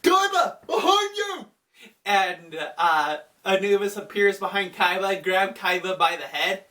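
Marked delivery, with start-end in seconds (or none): 0.0-1.5 very high mock warning shout; Yugi. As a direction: cry out in hammed-up alarm. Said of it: "Yugi's like".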